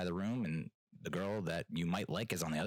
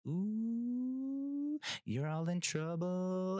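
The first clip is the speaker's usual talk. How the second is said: much higher, slow teasing sing-song; ominous DJ; 11 semitones higher, much slower than usual. Retold or imitated. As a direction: draw it out like a scolding tease. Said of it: "the way that he says it".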